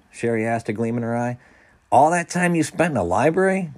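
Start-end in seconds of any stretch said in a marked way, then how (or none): 1.9-3.7 high, loud disbelieving question; incredulous. A narrator's reading. Peter questioning Sherry's reading, incredulous. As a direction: ask it in sheer disbelief. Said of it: "Peter asked incredulously".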